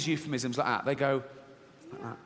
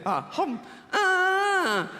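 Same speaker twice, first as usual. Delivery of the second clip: mock squeal; much slower than usual. Imitating Dodd and woman's squeal.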